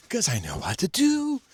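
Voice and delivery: high, slow, smug boast; facetious slow bragging; mock smug. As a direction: show off like a know-it-all, in jest. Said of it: "just being facetious of an asshole".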